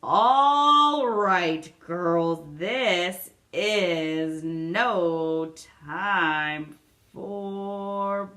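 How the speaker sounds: loud, drawn-out eerie command; a strange voice that stops the bickering dead; sharp strange voice. A narrator's reading. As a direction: cut in with an odd sharp voice. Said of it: "a sharp, strange voice cut in".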